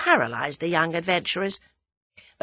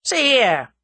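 loud, high suspicious challenge; 7 semitones higher, 7 dB louder than usual. A narrator's reading. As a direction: challenge them, suddenly suspicious. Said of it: "seemed to grow suddenly suspicious".

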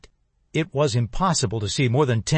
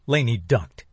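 plain reading voice

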